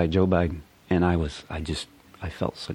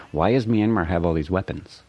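ordinary talk, nothing marked

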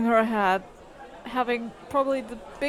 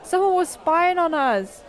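alarmed report; 7 semitones higher, 5 dB louder than usual. Acted. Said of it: "says, like".

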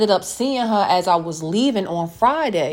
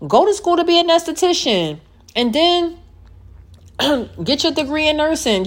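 higher, pushing the advice hard; emphatic urging; 8 semitones higher than usual. Real, not acted. Urging listeners toward a new career, high and emphatic. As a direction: sell them on the plan.

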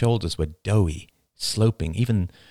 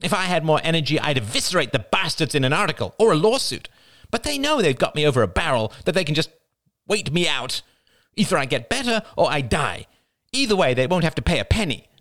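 higher, emphatic bitter rant; scowling bitterness; 8 semitones higher than usual. A narrator's reading. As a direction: rail against them, embittered. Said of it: "Crystal scowled".

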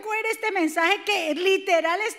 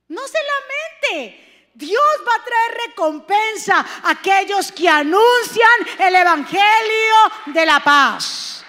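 slow, loud, rising sermon; fervent preaching; 3 semitones higher, 9 dB louder, slower than usual. Acted. Pastor urging the evangelists not to lament, loud. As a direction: proclaim it with full force.

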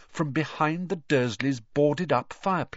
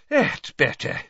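louder, out of breath with relief; panting; 4 semitones higher, 5 dB louder than usual. A narrator's reading. Mister Weasley just burst from the fireplace, panting. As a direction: pant it out after a struggle. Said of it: "panted Mister Weasley".

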